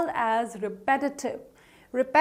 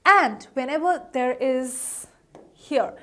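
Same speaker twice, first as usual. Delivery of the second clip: normal talking voice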